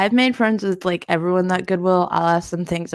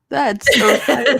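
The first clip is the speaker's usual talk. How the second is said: laughing; 6 semitones higher, 3 dB louder, slower than usual. Real, not acted. Laughing about the poor cashier.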